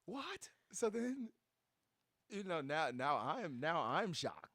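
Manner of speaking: high, loud, stunned; a friend stunned at the news, high and loud; shocked disbelief. Real, not acted. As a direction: blurt it out in shock.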